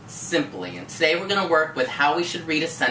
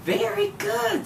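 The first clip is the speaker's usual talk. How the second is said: high praise. Real, not acted. Teacher praising warmly.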